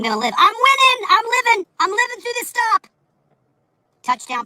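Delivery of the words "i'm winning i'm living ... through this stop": high, loud gloating; triumphant. Acted. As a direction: crow about winning the stop.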